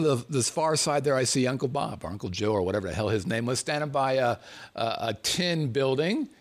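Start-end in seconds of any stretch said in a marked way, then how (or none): none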